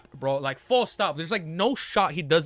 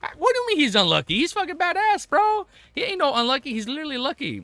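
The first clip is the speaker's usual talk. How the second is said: high, excited indignant protest; indignant defense; 8 semitones higher than usual. Real, not acted. Fan defending Anderson against being unlucky, indignant. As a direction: argue back in a much raised pitch.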